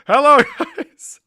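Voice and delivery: high, loud, drawn-out greeting; loud greeting breaking into laughter; laughing shout. Real, not acted. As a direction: holler it and crack up midway.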